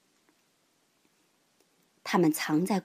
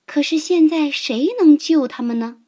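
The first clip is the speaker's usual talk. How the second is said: ordinary delivery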